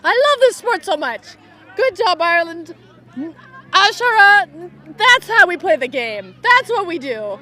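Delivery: high, loud gush of love for the game; thrilled fan at the microphone; elated cheering. Real, not acted. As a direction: praise the team with real delight. Declares love of the sport.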